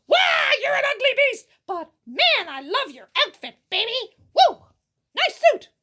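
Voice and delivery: high, loud, whooping compliments; excited shout. Acted. Merchant hollering praise at the beast, loud excited whoops. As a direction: cheer him on like a fan at a show.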